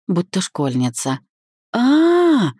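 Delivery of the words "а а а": loud, high squeal of glee; long, high "aah" like an excited child. A narrator's reading. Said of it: "начала она прыгать на месте, как маленький ребёнок".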